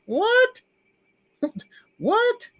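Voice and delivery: high squeaky "What?" with a laugh between; retold stunned reaction, voice jumping high; shocked disbelief. Retold shock at long recovery.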